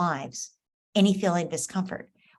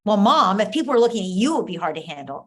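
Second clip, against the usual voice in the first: imitating a teen daughter snapping at her mother; annoyed daughter; 6 dB louder than usual. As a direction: snap back at mom, irritated. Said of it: "she could be really annoyed at you".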